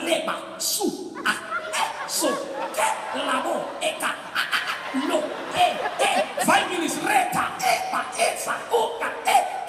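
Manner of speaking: hushed gibberish tongues, mostly whispered with sharp high yelps; mock speaking in tongues. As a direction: babble fake tongues under your breath.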